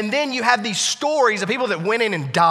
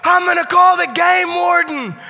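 yell; 11 semitones higher, 8 dB louder, slower than usual. Retold or imitated. Neighbor yelling from woods.

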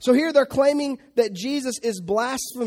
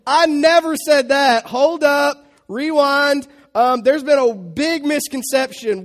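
mock panicked denial; 2 semitones higher, 8 dB louder than usual. Preacher playing Jesus wrongly denying to the Sanhedrin, hammed-up panic. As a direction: frantically take it all back, then ease off.

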